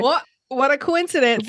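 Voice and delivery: lightly awkward, stiff line; awkward Stevie. Retold or imitated. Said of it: "hundred percent awkward".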